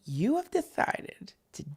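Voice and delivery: hushed, overly earnest letter recital; mock formal letter reading. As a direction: read it like a wounded, solemn letter. Reads the letter aloud.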